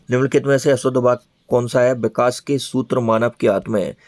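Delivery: usual speaking voice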